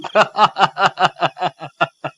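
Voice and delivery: staccato ha-ha cackle; hammed-up scoffing laughter; mocking laugh. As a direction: laugh off the ghosts in separate bursts. Mocks the idea of ghosts.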